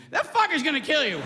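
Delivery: ordinary talk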